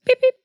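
very high chirpy beep beep; beep sound effect. Shown as a demonstration. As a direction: squeak it like a blooper buzzer. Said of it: "Here's the blooper sound effect".

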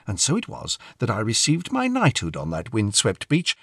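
ordinary delivery

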